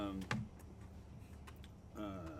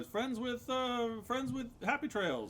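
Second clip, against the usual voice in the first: silly clown; 8 semitones higher than usual. Acted. GM voices clown, slow high.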